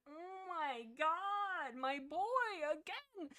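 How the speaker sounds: high, drawn-out mock wail; heartbroken lament. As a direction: lament it slowly in a high, softening voice. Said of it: "like, heartbreaking. And I'm like".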